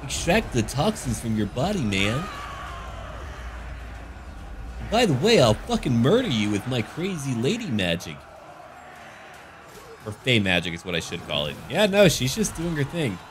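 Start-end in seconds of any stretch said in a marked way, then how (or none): 0.0-8.2 higher, airy hippie-lady voice pushing her remedies; mock hippie lady. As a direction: play a spaced-out hippie lady, then snap to a threat. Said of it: "being like".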